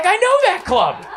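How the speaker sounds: high eager exclamation; excited driver. Retold or imitated. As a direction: chirp it up like a thrilled fan.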